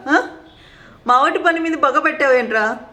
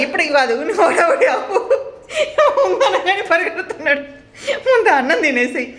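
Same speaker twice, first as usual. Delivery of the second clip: laughing while scolding; 4 semitones higher than usual. Real, not acted. Scolding dissolves into amusement.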